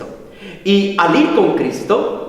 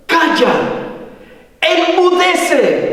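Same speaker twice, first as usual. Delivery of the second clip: high, forceful command to be silent; stern rebuke; 10 semitones higher, slower than usual. Retold or imitated. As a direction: rebuke the sea, drawing out the last word. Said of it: "reprendió al viento y dijo al mar".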